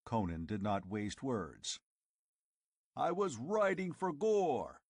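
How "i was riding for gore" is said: roaring. Acted. Conan roaring at the tribesmen on the trail. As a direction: roar it out like a war chief. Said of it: "he roared".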